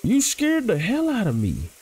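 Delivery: startled squeak that slips out. Real, not acted. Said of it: "Why am I talking like that?".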